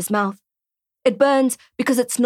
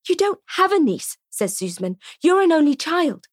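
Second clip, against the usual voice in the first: disbelief; 7 semitones higher than usual. Acted. Shocked disbelief.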